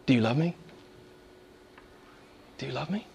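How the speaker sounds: very soft, low, near-hushed asking; tender question. Acted. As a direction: let the gentle question fade almost away. Voices Jesus's tender question.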